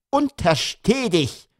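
high, drawn-out warning; warning mother. A narrator's reading. Mother forbidding father a beard, warning. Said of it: "rief die Mutter".

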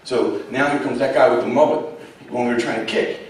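ordinary talk, nothing marked

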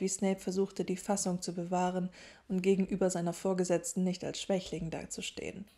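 usual speaking voice

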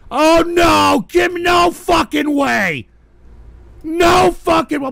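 high, loud horrified shout; shocked disbelief. Real, not acted. Streamer seeing a mocking video, horrified shout. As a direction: yell in stunned disbelief.

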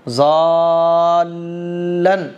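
"जालन" with long madd held into the l; stretched and joined. Shown as a demonstration. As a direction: draw out "जालन" slowly. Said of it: "जाद लाम से मिलेगा". ("जालन" को खींचकर लाम से मिलाकर)

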